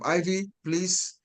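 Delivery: ordinary talk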